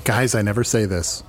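ordinary talk

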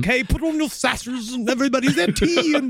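high, fussy put-on officer voice; mock captain. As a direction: play a prissy captain rallying the men. Mock captain readying his men.

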